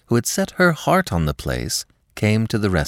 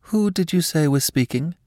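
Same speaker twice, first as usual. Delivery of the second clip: startled; 10 semitones higher than usual. Acted. Alex shocked on the phone.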